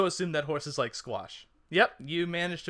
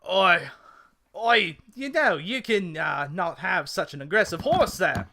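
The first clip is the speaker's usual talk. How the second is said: indignant mock-Cockney, loud opening; muddled Cockney-Australian telling-off; attempted Cockney; 3 dB louder than usual. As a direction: complain in an amateur Cockney accent. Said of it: "I was trying to go Cockney".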